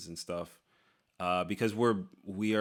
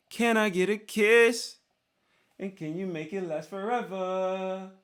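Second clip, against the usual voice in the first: much higher, slow, girlish put-on voice for the fan's line; mock high voice; 11 semitones higher, 6 dB louder than usual. Reading a fan's kiss request aloud in a mock high voice.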